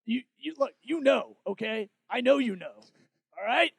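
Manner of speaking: frantic paranoid talk-show rant, building; Alex Jones impression. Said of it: "I didn't do Alex Jones".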